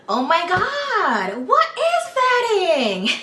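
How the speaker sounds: high, loud, drawn-out mock amazement; theatrical exclamation. Introducing the topic of vetting, theatrically over-excited. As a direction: wail it slowly with hammed-up drama.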